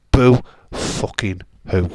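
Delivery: loud, slow, sneering boo fucking who; mocking taunt. Real, not acted. Streamer mocking a bitter viewer who lost at home, loud slow taunt. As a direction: drag it out to rub it in.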